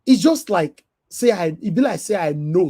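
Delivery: ordinary delivery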